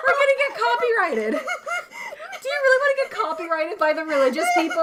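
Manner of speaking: high laughing voice; panicked laughing protest. Real, not acted. Laughing panic about copyright.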